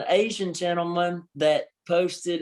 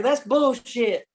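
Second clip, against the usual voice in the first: higher, drawn-out mock yell; re-enacted yell; 6 semitones higher, 3 dB louder, much slower than usual. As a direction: stretch it out like yelling at a referee. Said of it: "yelled it".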